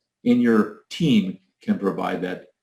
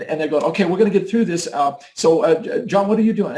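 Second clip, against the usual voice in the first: high, rushed, stumbling words; stressed leader voice; 7 semitones higher, faster than usual. Acted. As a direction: act flustered in a crisis, pitch up. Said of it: "So the voice goes up like this".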